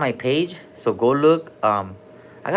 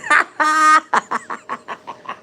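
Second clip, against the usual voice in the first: high giggle; 13 semitones higher than usual. Real, not acted. Laughs at own joke.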